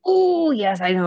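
can't contain the thrill; real excitement. Real, not acted. Friend gushing over a new book release, excited. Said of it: "Sorry, I just got real excited there".

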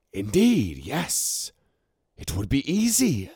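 the serpent sister agrees eagerly; Saramis voice. A narrator's reading.

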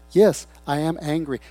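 ordinary delivery

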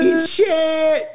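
high, far squeak; squeaky panda insult. Retold or imitated. Panda telling off the worker, squeaky. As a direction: spit it out as a tiny critter. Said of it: "panda voiced".

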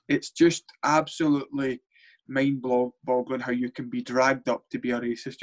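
ordinary talk, nothing marked

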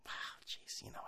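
whispered wow; hushed awe. Acted. Whispering in mock awe to the listener. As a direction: breathe it in awe.

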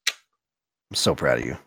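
low, slow mock-proud voice; mock proud parent. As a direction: play the proud parent, slowly. Mock praise of friend's math.

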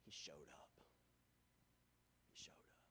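hushed, voiceless whisper; sermon point whispered to the congregation. Real, not acted. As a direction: breathe it out as a secret. Sermon climax on God's love.

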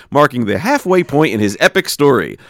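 ordinary talk, nothing marked

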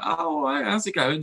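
high, sing-song hello; breezy fake-friendly hi; mock cheery greeting. Greeting the new warden with mock cheer.

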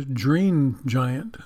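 "dream" said as "jreen", with the soft G of "giant"; slow "jreen giant", both words starting with a J sound; soft G in green. Shown as a demonstration. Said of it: "Why not the green giant".

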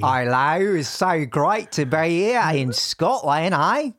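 slow Scottish attempt sliding into Australian; botched Scottish accent. Shown as a demonstration. Guest greeting Scotland, botched Scottish accent drifting Australian. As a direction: try Scottish and land in Australian. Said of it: "not a Scottish accent".

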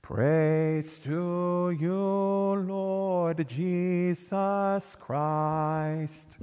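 ordinary talk, nothing marked

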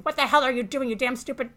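loud, high furious yell; angry yell. A narrator's reading. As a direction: shout at the ship as you run. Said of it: "you stood up yelling".

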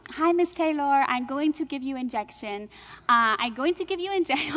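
imitating a nurse's Indian accent. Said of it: "she, with an Indian accent".

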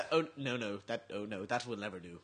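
mock disapproval. Playful prim refusal to split.

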